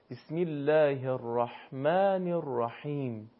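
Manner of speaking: slow recitation, ح in الرحمن squeezed in the throat; measured Quranic demonstration of ح in الرحیم; correct Arabic ḥā. Shown as a demonstration. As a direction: draw out الرحمن الرحیم with a lightly constricted throaty ح. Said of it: "حا در الرحمن و الرحیم".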